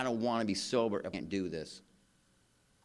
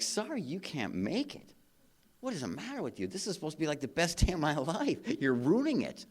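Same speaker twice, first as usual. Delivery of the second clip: mock outraged whine; 8 semitones higher, 4 dB louder than usual. To the date who cancelled by text, mock outraged whine. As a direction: play up the wounded outrage.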